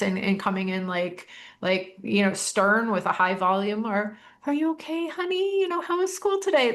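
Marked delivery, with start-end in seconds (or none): 4.5-6.7 put-on much higher, gentle voice to a child; soft parent voice. Shown as a demonstration. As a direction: coax a child tenderly after school. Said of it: "There's a time and a place for soft".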